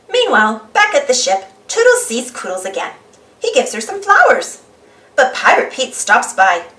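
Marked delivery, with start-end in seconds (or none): none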